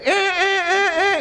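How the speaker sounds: high, slow squealing cartoon alarm "eh, eh". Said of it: "just like that movie Inside Out".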